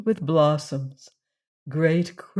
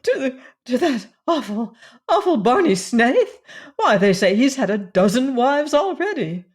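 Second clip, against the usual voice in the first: horrified; 7 semitones higher, 6 dB louder than usual. Acted. Cousin horrified by the groom.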